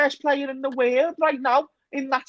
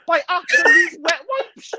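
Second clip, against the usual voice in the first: mock sob; 9 semitones higher, 4 dB louder than usual. Ranting, voice cracks into crying.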